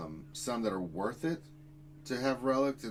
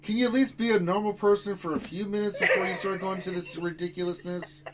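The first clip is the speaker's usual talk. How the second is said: higher-pitched, half-laughing telling-off; exasperated scolding; 7 semitones higher, 4 dB louder than usual. Real, not acted. Host scolding [name] for nonsense on the stream, exasperated. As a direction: scold a friend in mock exasperation.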